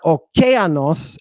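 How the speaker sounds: "okeanos" with the weight on "ke"; stress on second syllable. Shown as a demonstration. Teacher showing a wrong accent, second syllable stressed. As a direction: punch "ke" in "okeanos". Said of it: "and it's not".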